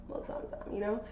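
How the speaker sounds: whispered, teasing suggestion; suggestive whisper. Retold or imitated. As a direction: slip it in as a sly whisper. Coy suggestive aside.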